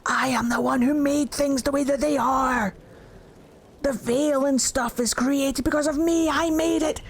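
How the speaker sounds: a hint of a proud boastful god; mildly grand proud claim; prideful Solas. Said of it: "Solas getting all prideful as he does".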